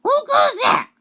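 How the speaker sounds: very high, loud shout; high-pitched imitation of a startled guard; acted challenge. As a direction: challenge an intruder, hammed-up. Acts out Tiger challenging an intruder.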